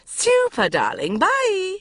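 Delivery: high, loud bright farewell; mother ringing off gleefully; chirpy mother. A narrator's reading. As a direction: trill a quick goodbye. Mother voiced, chirpy goodbye.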